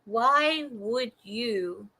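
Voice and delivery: "why would you" stretched very slow and soft; slow drawn-out question. Real, not acted. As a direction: draw out each word of "why would you" in disbelief. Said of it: "slowed retake".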